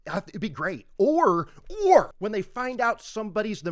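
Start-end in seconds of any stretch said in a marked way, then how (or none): none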